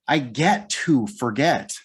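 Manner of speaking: slow down and land on "get"; stress on GET. Shown as a demonstration. Tutor contrasting this with accidentally forgetting, stress on GET. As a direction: GET drawn out in a slow, deliberate phrase. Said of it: "I wanna emphasize the word get".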